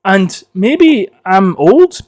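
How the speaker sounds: ordinary talk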